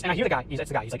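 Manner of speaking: ordinary delivery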